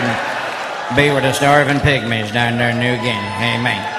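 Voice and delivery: low, preachy mock prayer to the Lord; hammed-up solemn prayer after a dirty joke. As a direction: drop low and pray like a preacher, tongue in cheek.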